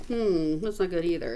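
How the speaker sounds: usual speaking voice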